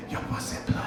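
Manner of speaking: whispered, barely audible aside; under his breath. Retold or imitated. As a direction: sneak it out in a whisper as she walks away. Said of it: "very quietly, under my breath".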